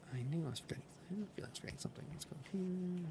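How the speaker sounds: whispered to himself. Real, not acted. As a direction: breathe it out under his breath. Muttering to himself while playing.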